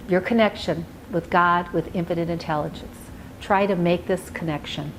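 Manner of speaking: soft, low meditation voice with long pauses; guided meditation. Acted. Life coach guiding a listener to relax and visualize, guided meditation. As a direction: ease the listener calmly into relaxation, leaving space between phrases.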